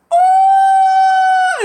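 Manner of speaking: very high, loud shocked "Oh!"; friend's shocked gasp. Retold or imitated. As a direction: shriek it like a stunned friend. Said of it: "her friend is like".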